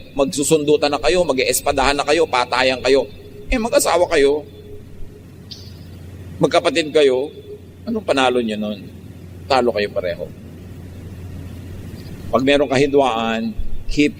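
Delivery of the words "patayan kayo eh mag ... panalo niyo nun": ordinary talk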